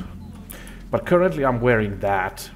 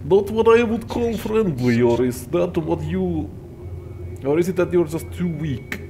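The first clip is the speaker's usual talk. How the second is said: higher, hammed-up Russian accent; 7 semitones higher than usual. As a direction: play a gruff Russian stalker, mocking. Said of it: "should I do this in a Russian accent".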